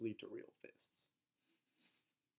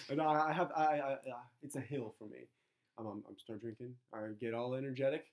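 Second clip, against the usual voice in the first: ordinary talk, nothing marked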